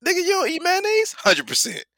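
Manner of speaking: high-pitched comic exchange; Dizzle. Retold or imitated. Friend asked about mayonnaise, high teasing imitation. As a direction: act out the question and his pat answer, high. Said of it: "My favorite shit that he does is when you say".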